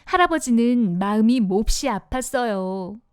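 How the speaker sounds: ordinary delivery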